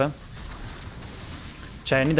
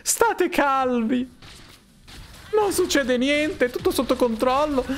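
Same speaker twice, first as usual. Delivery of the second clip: mock calming plea; 8 semitones higher, slower than usual. Calming the team in chat.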